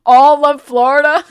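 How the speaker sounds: loud, slow, stretched disbelief; incredulous outburst. Real, not acted. To chat about disasters, a loud incredulous cry. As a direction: blurt it out in disbelief.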